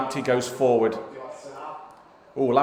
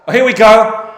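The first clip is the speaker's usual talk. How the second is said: higher, louder burst as the chance comes; excited commentary; 9 semitones higher, 13 dB louder than usual. Real, not acted. Dad calling a West Brom chance on stream, excited. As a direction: call the attack like a TV commentator.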